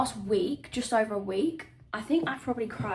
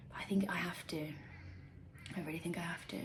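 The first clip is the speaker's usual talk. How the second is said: hushed, breathy resolve to herself; whisper; 4 semitones lower, 13 dB quieter than usual. Real, not acted. To herself, deciding to post the video, whispered. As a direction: whisper it to yourself, quietly convinced.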